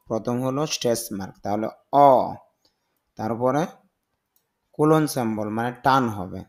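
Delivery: ordinary talk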